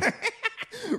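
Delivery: high, gleeful villain giggle; high Riddler cackle. Acted. Riddler taunting Batman with a high giggle. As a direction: cackle like a delighted trickster.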